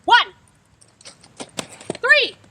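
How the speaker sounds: loud, high calls, each number dropping at the end; calling out lane numbers. Shown as a demonstration. Coach calling out partner's lane on the track, loud. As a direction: shout each lane number across the rink. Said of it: "I'm gonna say out loud where he is".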